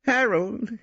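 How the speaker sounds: high, gently coaxing; suggesting Brittling. A narrator's reading. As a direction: nudge him to first names. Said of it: "he suggested".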